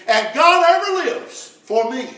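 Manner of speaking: high, loud and slow proclamation; preacher crowning the list to the congregation, exultant; triumphant climax. Acted. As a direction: lift it up and shout it out slowly.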